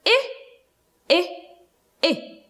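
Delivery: I, I, I as separate short "ih" sounds with pauses; short i drill. Shown as a demonstration. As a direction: model I as a short "ih" three times, spaced out.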